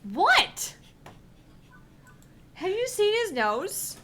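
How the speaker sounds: can't believe you missed it; incredulous. Real, not acted. Player presses the GM about the drawing, incredulous.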